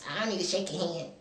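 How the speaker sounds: quick, low dismissal; curt mock brush-off; imitated brush-off. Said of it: "She go".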